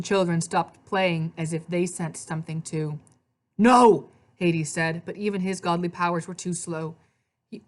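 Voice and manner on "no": loud, raised cry of refusal; alarmed shout. A narrator's reading. Hades as the hotel is about to explode, alarmed shout. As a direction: shout to stop disaster. Hades senses the blast coming.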